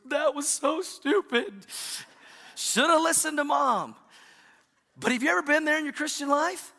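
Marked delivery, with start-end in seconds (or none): 0.1-4.0 put-on high, slow sulky boy voice; sulky kid. Son walking out of the store, high and sulky. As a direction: mope it like a chastened little boy.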